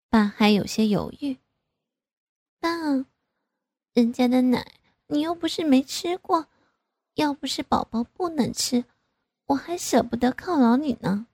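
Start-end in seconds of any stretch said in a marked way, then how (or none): 2.6-11.3 put-on higher, wheedling girlish voice; coquettish daughter. A narrator's reading. Daughter wheedling her father, higher playful voice. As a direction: pout and wheedle. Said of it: "我就撒娇地".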